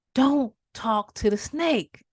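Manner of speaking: slowly drawn-out warning; very slow grave warning; slow ominous warning. Acted. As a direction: stretch every word like a omen. People kept warning him.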